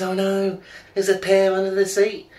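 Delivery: high, loud wife voice; wife calling back down the hill, high and loud. Retold or imitated.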